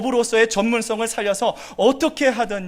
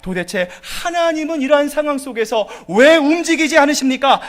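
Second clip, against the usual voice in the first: higher, louder bitter complaint; complaining to God; 4 semitones higher, 4 dB louder than usual. Retold or imitated. A believer complaining to God, bitter and louder. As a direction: whine at God in frustration. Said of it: "불평할 수 있다라는 거죠".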